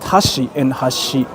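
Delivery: "hashi and hashi" slow and low, each with its own pitch pattern; contrasting pitch accent. Shown as a demonstration. Streamer explaining Osaka dialect swap, contrasting pitch on "hashi". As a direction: slowly set one "hashi" against the other. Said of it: "in Osaka dialect".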